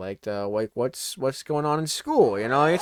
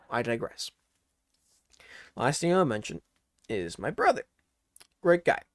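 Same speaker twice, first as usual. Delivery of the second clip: ordinary talk, nothing marked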